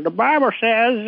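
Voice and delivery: high, drawn-out mock Southern preacher; terrible Southern accent. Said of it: "Southern accent".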